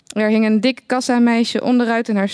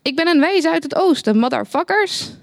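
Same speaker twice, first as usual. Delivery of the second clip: mild proclamation; 6 semitones higher than usual. A narrator's reading. Geert Wilders in a Christmas stable, calling out in a mild shout. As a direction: announce it up high, a restrained shout. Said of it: "en riep".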